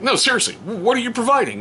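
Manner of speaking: dad demanding an answer from his son, incredulous. Retold or imitated. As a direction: push him for a real answer. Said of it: "I'm like".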